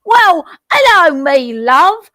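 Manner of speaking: loud hammed-up British hello, pitched high; mock British greeting. Host greets the fake British caller in mock British accent. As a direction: call out like a cheery London barmaid. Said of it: "I can't say whippet in a British accent".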